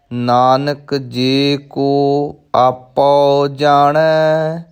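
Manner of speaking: slow, measured recitation with drawn-out syllables; scripture recitation. Shown as a demonstration. Teacher models a scripture line for learners, slow recitation. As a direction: recite each word slowly and evenly.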